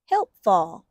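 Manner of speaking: ordinary delivery, nothing marked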